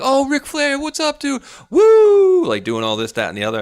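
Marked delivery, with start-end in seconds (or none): 0.0-2.5 much higher, louder starstruck fan shout; excited fan. Retold or imitated. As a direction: gush like a fan spotting his hero. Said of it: "he's like".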